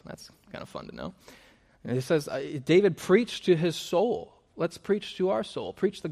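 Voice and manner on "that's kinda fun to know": whispered aside. Real, not acted. Whispered aside mid-sermon.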